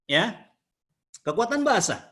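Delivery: ordinary talk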